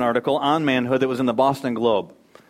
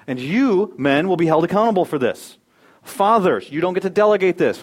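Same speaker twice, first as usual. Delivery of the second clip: raised, higher, louder pulpit voice; preaching at full force; 6 semitones higher, 4 dB louder than usual. Acted. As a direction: drive it home to the fathers.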